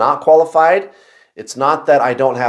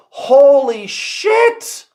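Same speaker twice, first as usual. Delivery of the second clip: shocked outburst; 11 semitones higher, much slower than usual. Retold or imitated. Recalling overwhelming obsessive thinking.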